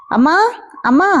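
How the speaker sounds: mock yell for mother, rising endings; drawn-up, questioning call. A narrator's reading. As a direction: call mother from another room, lightly. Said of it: "అని గట్టిగా అరుస్తూ వచ్చింది యశు".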